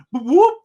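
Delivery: imitated police siren whoop, high and loud; mock police siren blip. Cop lights him up.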